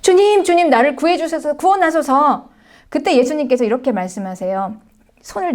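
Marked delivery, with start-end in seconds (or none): 0.0-2.5 crying out. Retold or imitated. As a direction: call out like a man sinking in the sea. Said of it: "소리를 지르면서".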